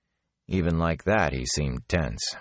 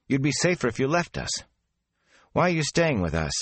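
put-on higher, pressing young voice; demanding; 9 semitones higher than usual. A narrator's reading. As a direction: push him for an answer. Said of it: "Mat demanded again".

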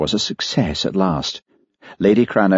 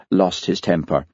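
plain reading voice

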